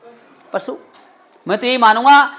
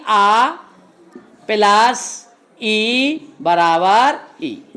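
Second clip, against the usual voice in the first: slow spelled-out formula; much slower than usual. Shown as a demonstration. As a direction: say plus ई बराबर ई very slowly, vowel by vowel. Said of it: "संधि प्रक्रिया क्या हुई?".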